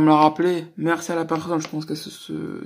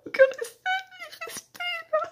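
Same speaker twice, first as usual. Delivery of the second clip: mock outrage. Mock outrage after a laugh.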